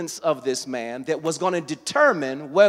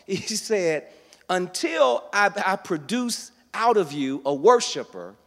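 raised, louder preacher's voice; full-force preaching; 5 semitones higher, 6 dB louder than usual. Acted. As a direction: build to a loud, high-pitched preaching peak. Preaches to a responding congregation.

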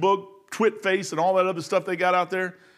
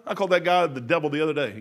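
ordinary talk, nothing marked

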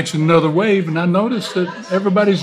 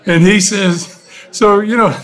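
normal talking voice

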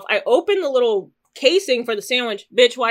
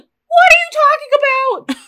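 high, loud and incredulous; a shrill-high what-are-you disbelief; baffled outburst; 7 dB louder, faster than usual. Retold or imitated. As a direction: blurt it out in total confusion. Baffled retort to mumbling stranger.

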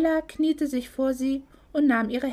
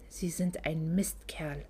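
slow, soft whispered insult; 6 semitones lower, 8 dB quieter, slower than usual. A narrator's reading. Isabella whispers at the villain Hank, slow whisper. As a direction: breathe the insult slowly. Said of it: "flüsterte Isabella".

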